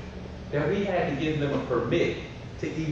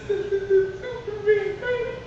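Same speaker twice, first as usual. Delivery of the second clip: put-on very high, slow little-kid voice; dreamy toddler; much slower than usual. Son in the back seat, slow high toddler coo. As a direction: drag it out like a happy toddler.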